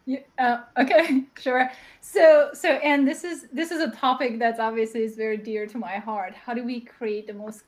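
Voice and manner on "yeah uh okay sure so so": ordinary delivery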